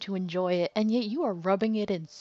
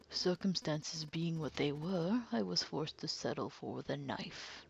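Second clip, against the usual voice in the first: calm sinister villain; 6 dB quieter than usual. Acted. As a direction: purr the confession like a cold killer. Villain describes the killing.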